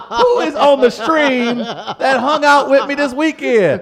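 hammed-up outraged yelling, stretching the words; mock outrage. Host protests being outed for sleeping in a club, mock outrage.

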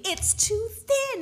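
high loud panicked yelp; mock scream. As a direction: scream it at the TV screen. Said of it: "[name] literally was screaming".

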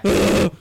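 a hammed-up dying scream; mock angel scream. Retelling the angels dying, hammed-up shriek. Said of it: "the angel's like".

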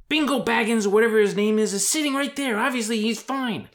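high, loud impatient outburst; loud exasperation. Acted. Character cutting off Sam's worry about his master, exasperated. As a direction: raise pitch and volume, fed up.

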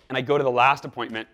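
ordinary delivery

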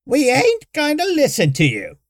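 put-on high, loud old woman's gripe; griping old woman. A narrator's reading. Old woman griping at the newcomers. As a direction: grumble it like a cranky old villager. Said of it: "Tiggy was griping".

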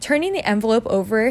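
plain reading voice

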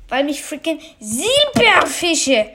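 ordinary delivery

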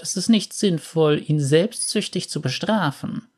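put-on an aunt gently counselling the boy; gentle old aunt.

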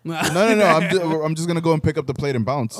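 hyped laughing bit about grabbing the plate and leaving; excited joke. Real, not acted. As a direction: pile on the joke with rising excitement. Excited joking about taking plates.

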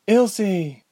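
high, loud urgent shout; urgent call. A narrator's reading. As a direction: call her name in alarm. Calling out in danger.

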